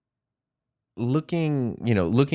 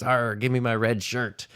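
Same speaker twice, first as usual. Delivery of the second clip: a lightly voiced pirate captain growl; half-hearted mock pirate, barely changed; terrible pirate captain voice; 3 semitones higher than usual. Telling a joke, he does the captain's order as a bad pirate. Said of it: "That is a terrible captain voice".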